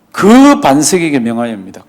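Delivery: "그"를 힘주어 짚고 잠깐 끊기; 반석 앞의 "그"를 강하게 찍어 말하기; stress on 그. Shown as a demonstration. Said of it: "그냥 반석이 아니고 그 반석입니다". ("그" 반석에 강세를 두어)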